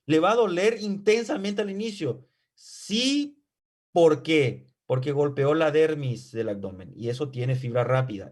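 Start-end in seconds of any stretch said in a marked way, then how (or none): none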